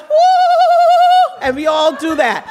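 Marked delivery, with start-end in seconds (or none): 0.0-1.4 very high loud jungle whoop; Tarzan yell. Retold or imitated. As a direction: whoop like Tarzan swinging on a vine. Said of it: "And she goes".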